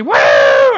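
loud crowd woo; big whooping shout; happy whoop. Acted. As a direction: cheer like a thrilled audience. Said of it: "they'll be so happy".

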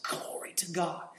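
hushed whispered praise. Retold or imitated. Preacher retelling his awed reaction, whispered. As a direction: breathe it out in awe. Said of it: "and I said".

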